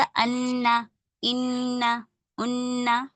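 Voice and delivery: अन्ना, इन्ना, उन्ना held with a nasal hum on the doubled n; teacher demonstrating ghunna on अन्ना इन्ना उन्ना. Shown as a demonstration. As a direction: hum the doubled n through the nose. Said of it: "गुन्ने के साथ पढ़ेंगे".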